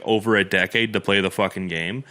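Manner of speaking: normal talking voice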